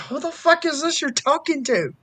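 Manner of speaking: high-pitched, incredulous question; retold bafflement. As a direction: re-enact being thrown by a stranger's voice. Retold baffled reaction to stranger.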